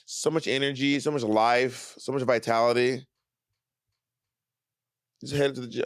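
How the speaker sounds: ordinary talk, nothing marked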